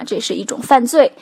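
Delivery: ordinary talk, nothing marked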